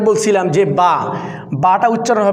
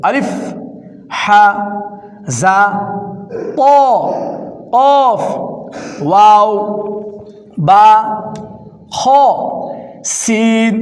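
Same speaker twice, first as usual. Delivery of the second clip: mixed letter drill; much slower than usual. Shown as a demonstration. Teacher reading letters out of order for practice. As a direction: call random letters like a quiz. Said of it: "দ্বিতীয় ধাপ হলো এইভাবে পড়ব".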